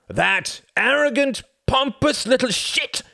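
slow, loud, high enraged bellow; enraged boss shouting about a rival; furious shout. A narrator's reading. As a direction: spit it out in a rage. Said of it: "he shouted".

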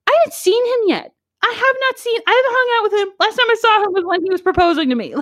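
high-pitched, retold exasperation; exasperated protest. Acted exasperated reply to aunt.

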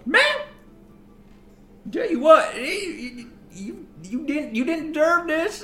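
high, halting silly woman's voice; stupid woman's voice. Acted. Woman feeding ma'am pie, stupid high voice. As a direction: put on her stupid voice, pitched well up. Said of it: "her voice was so stupid".

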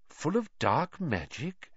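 slow, mild questioning Full of dark magic?; mild repeat. Acted. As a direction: echo it gently, unimpressed. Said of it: "he repeated mildly".

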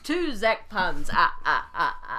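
slow, loud laughing tease; cackling over the pun. Real, not acted. Teasing over puns, laughing.

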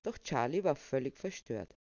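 ordinary delivery

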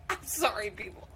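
ordinary talk

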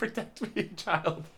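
high, mock-frightened plea; teasing friend mimics a scared mom, high and pleading; cowering mom. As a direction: whimper it like a coward behind a kid. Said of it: "you're gonna be the one hiding behind Lindley".

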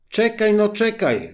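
high, loud call after her; frog calling out. A narrator's reading. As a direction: shout after the running princess. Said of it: "zawołała żaba".

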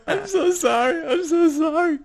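high, loud sobbing apology; retold crying. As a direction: wail the apology like a kid caught out. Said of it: "I start crying at this point".

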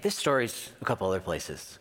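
ordinary talk

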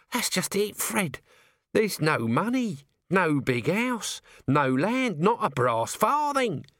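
high, drawn-out complaint; piling up grievances slowly; self-pitying working man's wail; woeful Nobby. Acted. Voices Nobby lamenting poverty.

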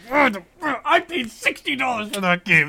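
high, slow, indignant complaint; outraged. Real, not acted. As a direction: protest it in disbelief. Complaining about a bought game.

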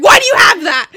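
high, loud scolding; dad scolding kids loudly; stern dad. Retold or imitated. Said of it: "He's like".

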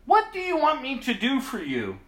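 high, loud call; Jesus calling back, high and loud. Acted. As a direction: call over the crowd to them. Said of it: "he stopped and called".

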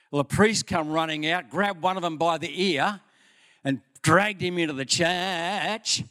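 ordinary talk, nothing marked

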